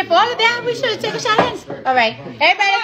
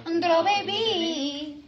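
slow, quieter drawn-out call; 8 dB quieter, much slower than usual. Real, not acted. Muttering the call again to friends, slow. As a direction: lazily drawl it again, softer. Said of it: "repeated drawn-out call".

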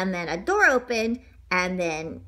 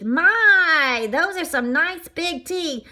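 high donut voice; 5 semitones higher, 4 dB louder, much slower than usual. Acted. Arnie voiced, admiring.

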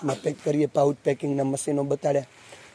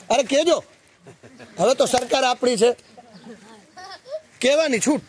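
higher, brighter carefree reply; cheerful boast; 8 semitones higher than usual. Retold or imitated. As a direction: toss it off like nothing can touch you. Watchman boasts freely after bribe.

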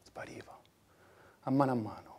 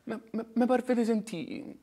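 shaken; 8 semitones higher than usual. Acted. Stammering shock.

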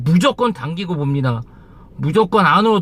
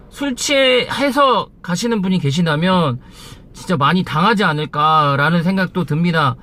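ordinary talk, nothing marked

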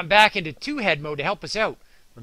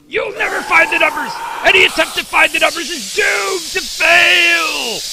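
loud, high gloating villain taunt; villain shout; 10 semitones higher, 9 dB louder than usual. Acted. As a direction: boom it out like a cartoon baddie. Ninja villains taunting the host.

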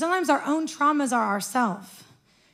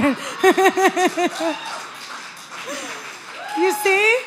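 laughing exasperation; 7 semitones higher than usual. Real, not acted. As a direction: laugh it out at the Lord.

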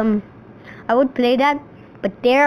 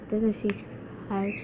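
ordinary delivery

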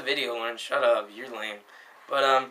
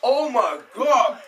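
mouth full of powder; 8 semitones higher, much slower than usual. Real, not acted. Just swallowed spoonful of cinnamon.

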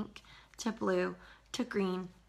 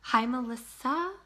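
ordinary talk, nothing marked

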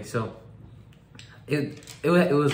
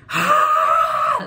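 laughing shriek; 7 dB louder than usual. Retold or imitated. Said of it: "Like, just laughing".

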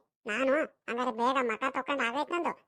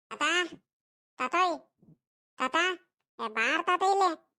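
raised voice calling across the house, a bit higher; calling out to grandpa; 4 semitones higher, slower than usual. Acted. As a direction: shout for grandpa to come. Boy calls grandpa from afar.

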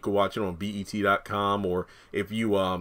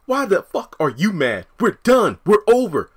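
higher, louder furious breakup yell; angry shout; 11 semitones higher, 9 dB louder than usual. Retold or imitated. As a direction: shut her down, angrily. Acted quarrel, Zach's lines.

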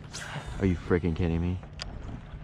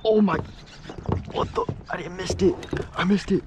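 high, frantic disbelief after the fish comes off; real excitement; 13 semitones higher than usual. Real, not acted. Angler losing a fish, high frantic disbelief. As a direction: blurt it out high and rattled, the last lines slowing. Just lost a fish on topwater.

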